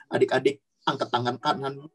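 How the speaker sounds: ordinary delivery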